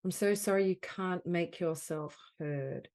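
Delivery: projected, emphatic apology phrases with long pauses; guided healing affirmation. Acted. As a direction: offer each phrase firmly to be felt.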